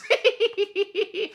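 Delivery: high-pitched giggle. Real, not acted. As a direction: let out a high, tittering laugh. Amused reading breakfast replies.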